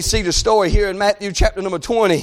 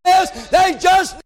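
raised, driving preacher shout; fervent preaching; 5 semitones higher than usual. Acted. Preacher telling how blind men cried out, fervent preaching.

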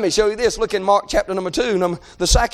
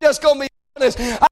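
fervent preached plea; 4 semitones higher than usual. Acted. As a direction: preach it as a pleading prayer, building at the end. Acts out a fervent plea to God.

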